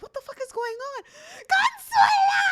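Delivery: fast and puzzled, then a high loud cry; the villa guests wonder at the noise, then the scream; mock confused shout. Impersonating people hearing him yell, high and loud. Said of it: "they're like".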